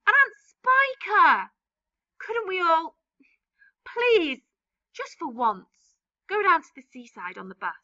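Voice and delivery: high, pitiful begging; a child's tearful plea; pleading boy. Acted. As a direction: beg them, near tears. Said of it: "James cried out".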